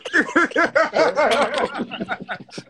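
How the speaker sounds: big burst of laughter; loud laugh. Real, not acted. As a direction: crack up loud. Laughing at a rhyme.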